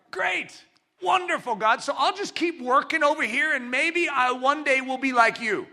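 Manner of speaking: high-pitched, chirpy agreement; hammed-up naive enthusiasm; mock eagerness. Acts out our naive eager reply.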